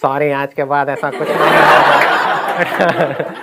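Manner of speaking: slow, drawn-out mock apology through laughter; funny teacher voice. PT teacher promising it won't happen again, slow funny voice. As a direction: stretch the sorry out comically. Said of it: "उनकी आवाज़ थी बड़ी funny सी".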